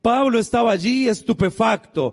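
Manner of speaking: high-pitched, fervent sermon storytelling; forceful preaching. Real, not acted. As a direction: retell the scripture with preacher's urgency. Preaching forcefully about Paul.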